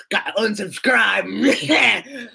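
high, drawn-out mock shout; mocking fickle viewers. Imitating viewers who quit at a thousand, mocking shout. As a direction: shout it slowly as a silly caricature. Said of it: "everyone's like".

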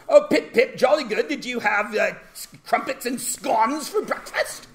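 high, hammed-up British toff; exaggerated British impression, high-pitched; mock British accent. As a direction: put on a jolly, cartoonish British accent. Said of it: "What if a British person came to class and I started going".

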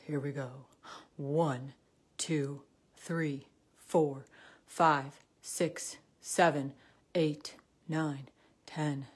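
low, loud counting voice; deep voice. Shown as a demonstration. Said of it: "with a really deep voice".

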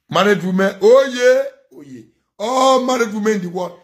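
high, loud call-out to married women; loud, high-pitched rallying shout; excited hailing. Real, not acted. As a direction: cheer them on at the top of the voice.

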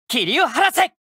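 high, ringing command; fierce finishing call; commanding battle cry. Acted. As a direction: clear the fog with one shout. Elemental burst cry.

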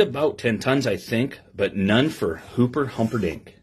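lively storybook reading to a class; reading to children. Acted. Teacher reading a picture book to her class, lively storybook voice. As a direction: read the rhyme aloud with bounce for the kids.

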